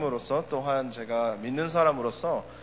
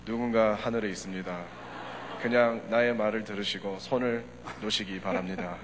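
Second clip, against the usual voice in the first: a lightly lowered voice from heaven; deep heavenly voice; 5 semitones lower than usual. Retold or imitated. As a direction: answer calmly as God from above. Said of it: "아주 깊은 목소리로".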